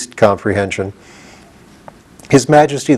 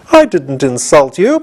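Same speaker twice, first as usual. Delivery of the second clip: indignant; 6 semitones higher, 3 dB louder than usual. A narrator's reading. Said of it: "protested Jack indignantly".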